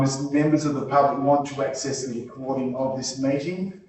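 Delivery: usual speaking voice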